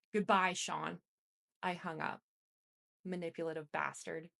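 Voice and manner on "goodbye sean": louder, final, decisive goodbye; firm conviction. A narrator's reading. Narrator ends the call, firm and much louder. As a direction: shut the door on him with the word. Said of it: "with much more conviction".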